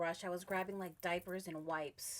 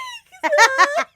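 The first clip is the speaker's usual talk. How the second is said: crying laugh; 23 dB louder than usual. Retold or imitated. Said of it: "I cried".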